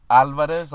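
Alvarez stressed on the first syllable, AL-varez. Shown as a demonstration. Explaining his last name, loud AL-varez. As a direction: punch the opening A of Alvarez. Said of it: "the first A in Alvarez is the A".

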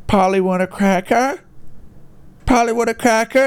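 high, squawky "Polly want a cracker?"; parrot voice. Retold or imitated. The pirate's parrot, squawking at him, high. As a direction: chirp it like a talking parrot. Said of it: "he's like".

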